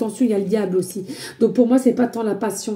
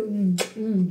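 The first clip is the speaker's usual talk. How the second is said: normal talking voice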